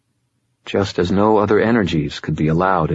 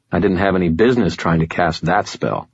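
ordinary delivery, nothing marked

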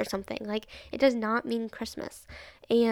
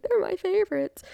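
high, louder put-on voice; mock reply; 9 semitones higher, 5 dB louder than usual. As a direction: toss it off in a silly character voice. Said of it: "I'm like".

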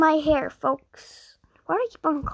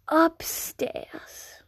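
whisper. Real, not acted. Hide and seek, guessing hiding spot.

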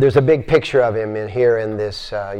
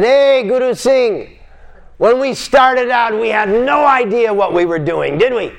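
higher, calling across a big room; retold yell across the room; 9 semitones higher, 3 dB louder than usual. The old teacher calling across the room to his student, a mock yell. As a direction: call out to him from the far side. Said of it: "he yelled across the room".

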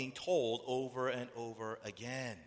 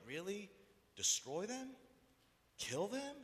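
high, rising disbelief; incredulous questioning; 7 semitones higher, 4 dB quieter than usual. Acted. Listener incredulous at God's reaction.

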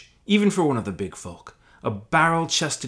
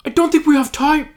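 much higher desperate hobbit voice; pleading Sam; 9 dB louder than usual. Acted. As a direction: beg the giant frantically.

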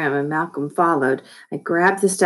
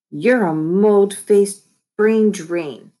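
slow, louder, higher taunt; taunting insult; 4 semitones higher, 5 dB louder, much slower than usual. A narrator's reading. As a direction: draw out every word of the insult. Trading insults in a quarrel.

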